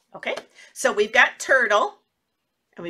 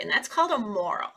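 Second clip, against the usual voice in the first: ordinary talk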